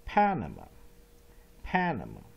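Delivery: Panama spoken slowly, each syllable drawn out; slow model pronunciation. Shown as a demonstration. Teacher models Panama for students, slow and clear. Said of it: "画过来".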